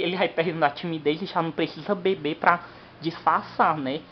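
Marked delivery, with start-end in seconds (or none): none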